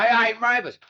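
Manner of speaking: calling out loud. Retold or imitated. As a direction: call her name out to get her attention. Charlie calling his sister.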